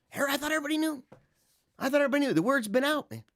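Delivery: high, hammed-up surprise that anyone could not know; mock astonishment. Host pretending his show is world famous, mock amazed. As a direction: feign shock, all in jest.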